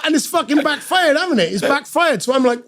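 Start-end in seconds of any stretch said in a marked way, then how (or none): none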